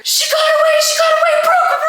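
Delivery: very high, loud shriek; mock panicked scream. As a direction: scream a warning, imitating a panicked mother. Mother screaming a warning.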